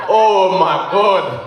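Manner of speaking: slow, drawn-out mock moaning, climbing high then dropping off; hammed-up ecstasy in a changed voice; sounding like a different person. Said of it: "you sound like a different person".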